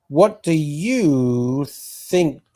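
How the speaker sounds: very slow, stretched "What do you think?"; drawn-out read. Real, not acted. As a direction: drag each word of "What do you think?" out. Reading chat question aloud.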